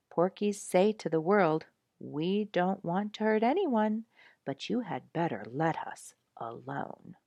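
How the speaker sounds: ordinary delivery, nothing marked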